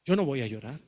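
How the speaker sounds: low, soft, held-in resolve; hardened. Acted. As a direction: hold back tears with a hard, quiet refusal. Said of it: "hay mujeres que se endurecen y dicen".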